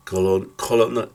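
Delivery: usual speaking voice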